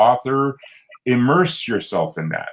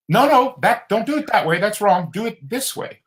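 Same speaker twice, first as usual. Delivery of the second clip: lightly voiced babysitter, higher, correcting; babysitter's voice; 12 semitones higher than usual. Retelling the babysitter's words to eight-year-old him, high and bossy. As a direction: imitate her bossy instruction. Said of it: "she said".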